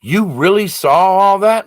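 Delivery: a doctor thrown by what he hears; amazed doctor. Retold or imitated. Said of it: "And he was like".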